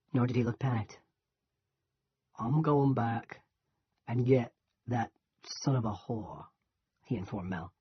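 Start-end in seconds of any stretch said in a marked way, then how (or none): 2.4-6.5 menacing. A narrator's reading. Menacing threat from gunman.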